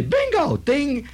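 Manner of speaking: a sudden high bingo call and ding; excited call. Retold or imitated. Bingo player calling a win, high and excited. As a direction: yell out the win at the bingo table. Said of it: "somebody would say".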